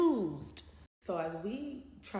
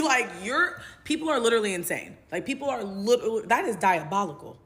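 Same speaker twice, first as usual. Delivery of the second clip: incredulous; 4 semitones higher, 8 dB louder than usual. Real, not acted.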